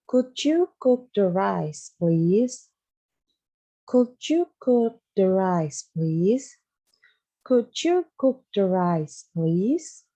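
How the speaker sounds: "Could you cook the rice, please?" said slowly and carefully; slow model sentence. Shown as a demonstration. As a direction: read it slowly so the class can follow. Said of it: "Gimana ya ngomongnya?". (ucapkan kalimat perlahan dan jelas)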